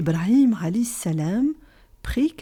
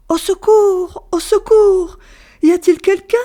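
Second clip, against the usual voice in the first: high, loud desperate cry for help across the desert; calling for help; 10 semitones higher, 8 dB louder than usual. Acted. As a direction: shout it out, calling far for anyone. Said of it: "elle commença à appeler au secours".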